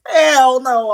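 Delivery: high, loud exclamation; loud disgusted outburst. Real, not acted. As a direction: blurt it out hard. Ranting about eating at Arby's.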